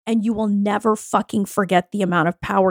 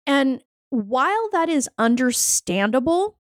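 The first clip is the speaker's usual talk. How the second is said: ordinary talk, nothing marked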